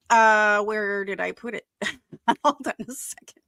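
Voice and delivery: ordinary talk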